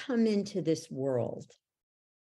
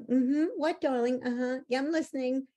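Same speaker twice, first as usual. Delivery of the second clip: much higher, absent sing-song mom voice; distracted mom; 9 semitones higher than usual. Mother on her phone brushing off child, high absent voice. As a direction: answer the child without really listening.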